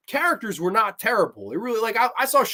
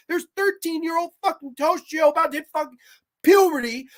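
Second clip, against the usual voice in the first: real exasperated outburst, pitched high; worked up and indignant, high-pitched; worked-up rant; 7 semitones higher than usual. Real, not acted. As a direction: rant in disbelief, voice pushed high. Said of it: "that fucking little outrage outburst I had".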